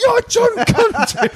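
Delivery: high slow excited exclaim. Retold or imitated. Retelling how he greeted his old school friend, slow high exclaim. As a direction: shout the name out in slow delight. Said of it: "I went".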